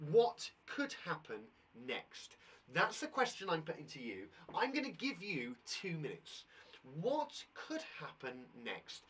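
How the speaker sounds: normal talking voice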